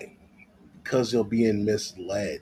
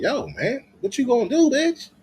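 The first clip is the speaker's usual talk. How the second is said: rising high mock lecture; lightly voiced preachy coach; imitating Kevin Samuels; 11 semitones higher than usual. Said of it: "He was telling him".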